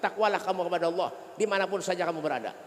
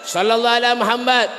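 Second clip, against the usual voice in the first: called out; 8 dB louder than usual. Shown as a demonstration. Salawat called to the congregation.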